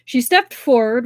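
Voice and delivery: ordinary delivery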